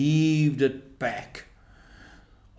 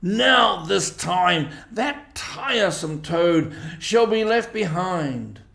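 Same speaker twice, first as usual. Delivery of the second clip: slow, raised, stern and angry; angry badger; 6 semitones higher, 3 dB louder, slower than usual. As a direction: lay down the law, slowly. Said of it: "he was very angry indeed".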